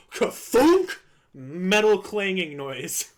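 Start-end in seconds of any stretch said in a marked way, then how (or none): 0.1-1.0 high, loud imitated mechanical thunk; imitated clunk. Employee imitates what was heard over the phone, loud clunk. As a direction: punch it out like a crashing noise. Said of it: "I hear the sound".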